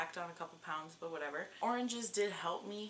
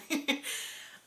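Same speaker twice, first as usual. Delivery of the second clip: laugh; 8 semitones higher, 4 dB louder than usual. Real, not acted. Laughing at failed announcement plan.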